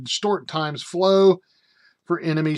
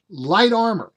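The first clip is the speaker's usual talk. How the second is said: high, louder, incredulous exclamation; amazed emphasis; 7 semitones higher, 5 dB louder than usual. Real, not acted. Reader floored by the light armor stats, amazed emphasis. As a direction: punch "Light armor" in astonishment.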